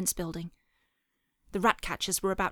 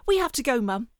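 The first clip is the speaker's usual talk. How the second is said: put-on high, louder child's urging; urgent plea; 5 semitones higher, 5 dB louder than usual. A narrator's reading. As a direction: urge Mum to get moving. Boy fleeing bullies pleads with mother.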